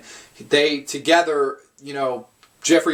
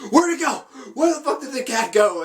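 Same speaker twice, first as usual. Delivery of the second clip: much higher, frantic mock panic; Jeffrey Combs frantic; 11 semitones higher than usual. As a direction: squeal it out like a manic scientist who lost the cat. Imitating Combs losing the cat.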